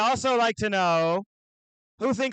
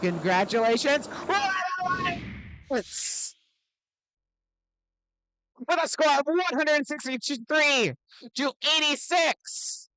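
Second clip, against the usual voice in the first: high, bouncy hype-announcer voice; broad singsong kids theatre; 5 semitones higher than usual. Acted. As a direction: pump up the crowd like an emcee. Announcing winners, calling to crowd.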